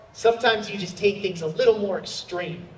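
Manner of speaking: ordinary talk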